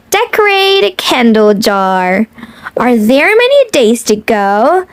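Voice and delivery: lilting picture-book read-aloud; reading to children. Acted.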